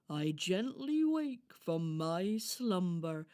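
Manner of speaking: loud, slow storybook hedgehog; reassuring hedgehog character. Acted. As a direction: explain proudly, stretching the words. Voices Harris the hedgehog.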